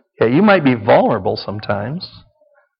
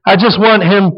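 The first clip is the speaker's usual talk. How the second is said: mock wife, higher and a bit louder; rescuing wife; 6 semitones higher, 3 dB louder than usual. Wife defending the bleeding child, lightly acted. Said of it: "now she's back to rescuer".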